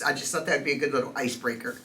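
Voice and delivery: normal talking voice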